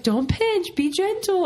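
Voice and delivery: high, pleading "don't pinch"; mock pained mom. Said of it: "I'm like".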